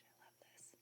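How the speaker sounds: delighted whisper; whispered "I love this" in delight. Real, not acted. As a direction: breathe "I love this" with no voice. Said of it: "Sorry, I'm whispering".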